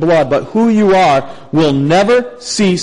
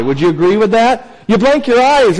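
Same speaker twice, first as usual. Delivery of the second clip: usual speaking voice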